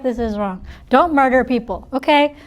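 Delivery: usual speaking voice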